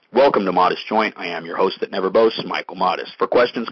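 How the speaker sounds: normal talking voice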